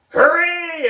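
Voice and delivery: high loud hooray; high excited shout from the kids; cheering shout. Acted. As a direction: cheer it like a happy class. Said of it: "everybody shouted".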